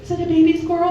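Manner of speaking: lightly voiced gushing mom. The kid's mom gushing over the baby squirrel, mildly high. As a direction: gush over it like a charmed mom. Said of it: "the mom's like".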